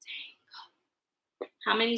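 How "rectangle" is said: "Rectangle" whispered very softly in separate parts; whispered syllables. Shown as a demonstration. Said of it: "Whisper with me".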